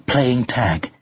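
usual speaking voice

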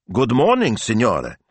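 Italian shop worker's hello; polite shop greeting. A narrator's reading.

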